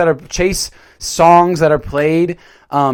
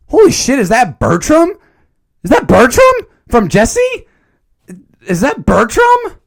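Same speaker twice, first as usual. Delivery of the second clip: shocked surprise; 8 semitones higher, 6 dB louder than usual. Real, not acted. Recognizing an actor on screen.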